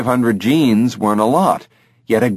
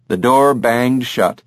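ordinary delivery, nothing marked

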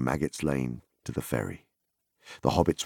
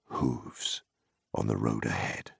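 whispered, soft and fearful; hushed dread; 7 semitones lower, 7 dB quieter than usual. A narrator's reading. Narrator telling the dreaded hooves, in a soft whisper. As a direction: breathe it out as if afraid to be heard.

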